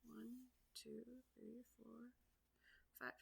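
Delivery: soft unvoiced count; whispered counting. Real, not acted. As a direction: count under your breath. Counting the bends softly.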